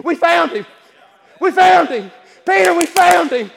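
loud and high, bursting with news; excited Andrew. Acted. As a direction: shout it to your brother like you can't hold it in. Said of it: "I'm kinda picturing it this way".

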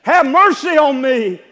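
high, loud, shouting plea for a sick daughter; desperate plea. Acted. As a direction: cry out to him as a desperate mother. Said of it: "fall down at a man's feet and say".

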